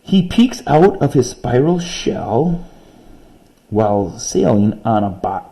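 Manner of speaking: slow, lilting read-aloud of a poem; slow expressive poem reading. Shown as a demonstration. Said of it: "this one's got rhyming".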